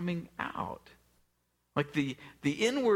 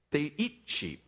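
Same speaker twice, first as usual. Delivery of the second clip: usual speaking voice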